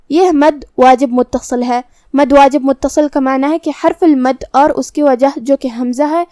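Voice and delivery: ordinary delivery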